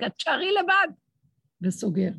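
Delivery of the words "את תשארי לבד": mock yell. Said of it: "וצועק".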